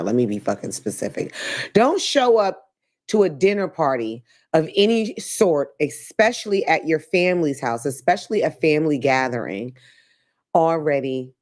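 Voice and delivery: ordinary talk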